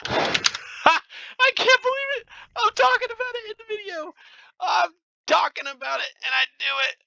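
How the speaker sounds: high-pitched, laughing disbelief; annoyed. Real, not acted. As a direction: squeal in exasperated disbelief. That's so annoying.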